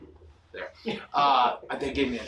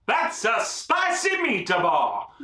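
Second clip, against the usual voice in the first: drawn-out yell, higher and slowed; fan yell; 9 semitones higher, 4 dB louder, slower than usual. Retold or imitated. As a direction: holler it like a fan in the stands. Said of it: "And I yelled".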